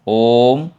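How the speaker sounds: "ओम" with a long held "ओ"; prolonged vowel. Shown as a demonstration. Teacher demonstrates the pluta vowel in Om, drawn out. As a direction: stretch the start of "ओम" slowly. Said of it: "उसी स्वर को आगे खींचता".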